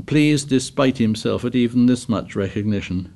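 ordinary delivery, nothing marked

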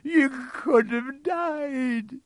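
very high, slow, drawn-out wail; sobbing. A narrator's reading. Hagrid fears for Harry's life, sobbing. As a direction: wail it loudly through acted sobs. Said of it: "sobbed Hagrid".

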